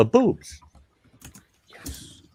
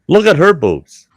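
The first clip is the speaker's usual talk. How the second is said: retold blurt; 8 semitones higher, 10 dB louder than usual. Doctor retold, blurting to narrator.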